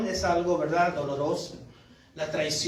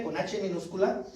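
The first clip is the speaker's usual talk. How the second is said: usual speaking voice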